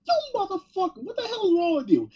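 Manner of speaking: high-pitched outraged outburst, played for laughs; mock rage. Imagining Damian's reaction to a teammate stealing his win, mock rage. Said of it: "looks at this dude with utter disgust and pure rage".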